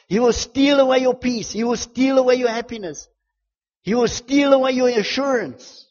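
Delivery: loud, high, impassioned preacher; full-force preaching. Real, not acted. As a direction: drive it home to the congregation.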